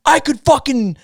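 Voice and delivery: mock violent threat. Re-enacting his threat on a heckler's voicemail, mock violent. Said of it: "all these violent voicemails".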